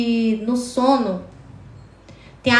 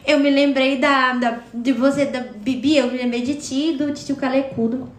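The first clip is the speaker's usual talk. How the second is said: usual speaking voice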